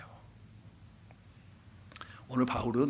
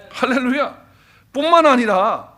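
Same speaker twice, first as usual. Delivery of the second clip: ordinary delivery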